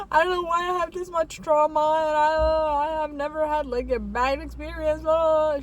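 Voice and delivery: high, fast, half-tearful retelling; retold crying. To the doctor, mock-tearful, rushing. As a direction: imitate herself blubbering to the doctor. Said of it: "and I started crying".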